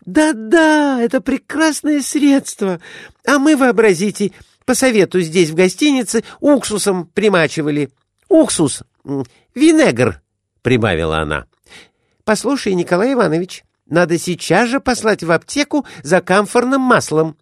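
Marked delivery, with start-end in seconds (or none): 0.0-10.2 higher, quick eager lady; lady's voice. A narrator's reading. Glafira chatters. 12.2-17.4 higher, quick eager lady; lady's voice. A narrator's reading. Glafira orders husband.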